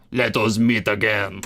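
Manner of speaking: slow, hammed-up French accent; accidental overdone French accent; exaggerated French accent. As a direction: read it like an over-the-top Frenchman. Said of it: "exaggerated French accent".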